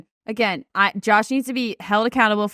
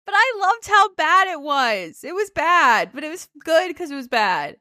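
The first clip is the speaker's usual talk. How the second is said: delighted amusement; 7 semitones higher than usual. Real, not acted. Delighted by the bad joke.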